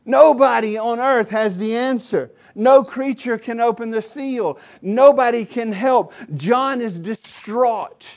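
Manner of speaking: high, loud lament that nobody can help; preaching climax. Real, not acted. Preacher crying out that nobody can help John. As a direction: build the hopelessness to a peak.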